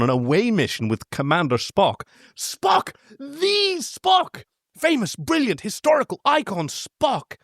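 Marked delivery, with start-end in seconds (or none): 2.4-7.3 high, gushing fanboy excitement; starstruck. Acted. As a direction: squeal his hero's name. Brad gushing over his idol.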